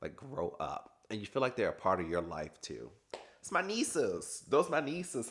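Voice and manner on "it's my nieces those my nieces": high, slow and proud, "my nieces"; sassy doting aunt; playful claim. Real, not acted. Claiming the kids as family.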